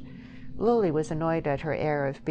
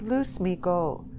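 pleading; 4 semitones higher, slower than usual. A narrator's reading. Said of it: "she pleaded".

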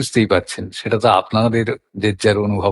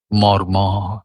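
মর্মর low and evenly drawn out; soft low glide; 5 semitones lower, much slower than usual. Shown as a demonstration. Reciter showing wind through leaves, soft gliding মর্মর. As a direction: let মর্মর roll gently in a low register, no stress. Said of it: "জোরালো ঝোঁক কোথাও নেই".

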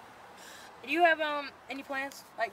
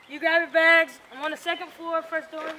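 calling out; 6 semitones higher, 10 dB louder than usual. Acted. Host telling cousin to grab bags.

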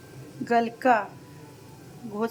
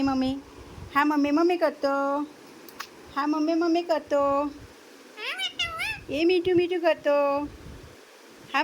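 higher, sing-song coaxing voice; pet talk; 5 semitones higher, 4 dB louder than usual. Real, not acted. Mother coos to her parrot Mithu while cooking, in a high sing-song voice.

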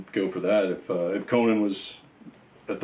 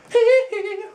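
loud falsetto squealing laugh; high squeal; 6 dB louder than usual. Pretend. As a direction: let out a very high, pop-star style hee-hee. Moonwalk squeal while playing with figure.

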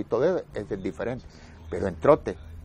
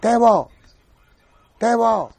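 high, loud calls across the barracks; shouted name; 9 semitones higher, 6 dB louder, slower than usual. Retold or imitated. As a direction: bellow the name like a captain. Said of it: "pega unos gritos en la cuadra". (el capitán grita el nombre en la cuadra)

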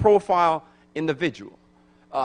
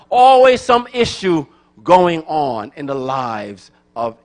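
loud, high, fervent preaching; preaching at full force; 7 semitones higher, 10 dB louder than usual. Acted. Pastor assuring the congregation God is able, preaching at full force. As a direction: drive the promise home to the pews. Preaching God's power over issues.

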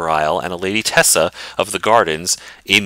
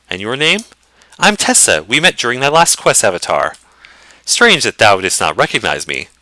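higher, courtly lady, glad and gracious; noble lady voice; 5 semitones higher than usual. Acted. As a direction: play the lady of the keep, warmly welcoming.

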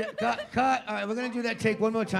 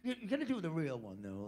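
normal talking voice

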